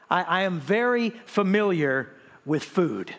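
normal talking voice

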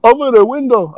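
high mock reply; a lightly voiced scared guy explaining; imitating the man. Retold man answers.

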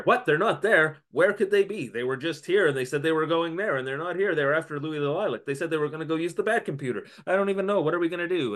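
high, fast, flustered gabble; frantic voice on the phone; panicked caller. Acted.

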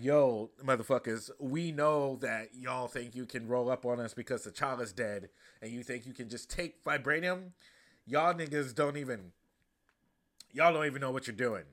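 loud, forceful retold threat; imitating an angry queen. Queen warning the United Nations, loud and angry. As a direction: scold the world leaders like a furious queen. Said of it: "And she's like".

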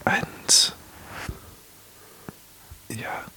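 hushed podcast whisper, breathy and close. Real, not acted. Whispers all through podcast.

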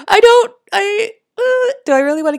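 high, laughing self-doubt. Real, not acted. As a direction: laugh through the words.

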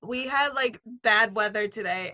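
ordinary delivery

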